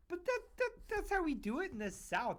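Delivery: high-pitched stammered retold objection; mock protest. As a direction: act out a flustered defense, voice way up. Acting out his protest to critics.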